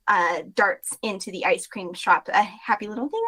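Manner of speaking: normal talking voice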